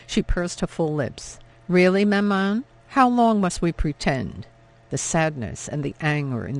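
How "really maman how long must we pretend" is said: raised, pleading challenge; exasperated daughter. A narrator's reading. Daughter confronts her mother, exasperated and louder. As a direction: push back hard at her mother.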